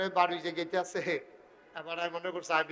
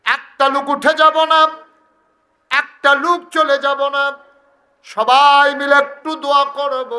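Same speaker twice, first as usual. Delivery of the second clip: high, very loud call to the crowd; shouted command; 8 semitones higher, 15 dB louder than usual. Real, not acted. Preacher telling the crowd not to leave before prayer, shouted. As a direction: order them all to stay, at full volume.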